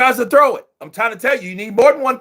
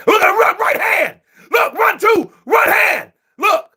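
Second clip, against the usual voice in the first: excited yelling; 11 semitones higher than usual. Real, not acted. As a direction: pitch jumps way up, rapid ringside calls. Live fight, hands landing.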